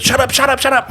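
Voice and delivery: high, rapid-fire imitation of interrupting; urgent hushing. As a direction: cut in frantically, as the friend. Friend hushes him, retold.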